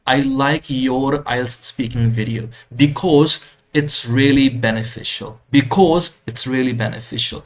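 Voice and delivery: slow, up on "because", down at "beneficial"; slow rise-fall demo. Shown as a demonstration. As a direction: go up on "because", come down at the end. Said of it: "तो ऊपर जाना, नीचे आना".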